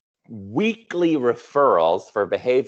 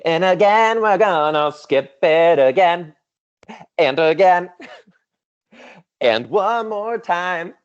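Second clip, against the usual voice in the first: high, loud, laughing at her own tech fumbles; amused exasperation; 9 semitones higher, 5 dB louder, slower than usual. Real, not acted. Presenter laughing as slides skip again and again. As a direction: ham up the slide mishap with a laugh.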